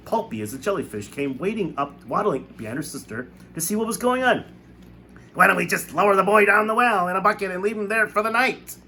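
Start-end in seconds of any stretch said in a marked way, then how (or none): 5.3-8.8 high, loud, sneering voice; a cruel aunt suggesting a cruel punishment; mean Aunt Sponge proposing the well; spiteful aunt. A narrator's reading. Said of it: "she suggested".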